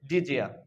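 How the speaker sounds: "ডিজিয়া" with d and y fused into j; linked pronunciation. Shown as a demonstration. Teacher gives the resulting pronunciation, "ডিজিয়া" with a j link. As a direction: run it together as di-jia, "ডিজিয়া". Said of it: "তখন আমরা ডিজিয়া উচ্চারণ করব".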